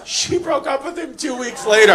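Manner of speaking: loud, high punchline through laughter; laughing shout. Real, not acted. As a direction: belt the payoff while cracking up.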